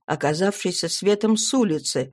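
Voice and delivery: plain reading voice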